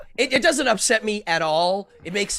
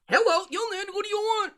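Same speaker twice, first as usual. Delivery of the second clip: much higher voice, seemingly from inside the cup; thrown voice; 13 semitones higher, faster than usual. Shown as a demonstration. Ventriloquist demonstrating voice throwing for the host, higher and from the cup. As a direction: throw the voice so it seems to come from the Dracula cup. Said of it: "So the voice throwing trick".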